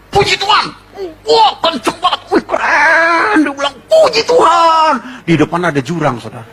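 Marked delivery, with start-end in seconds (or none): 0.1-4.9 excited shout. Acted. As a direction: shout it as the horse runs faster. Rider urges horse faster.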